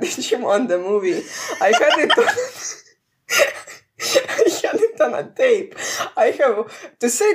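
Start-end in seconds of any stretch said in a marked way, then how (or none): 1.6-5.8 laughing. Real, not acted. Laughing about the tape.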